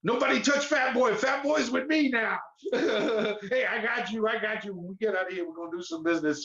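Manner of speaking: high, swaggering jailhouse tough guy; tough inmate voice. Retold or imitated. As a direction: claim him like a protective inmate. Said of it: "they're all like".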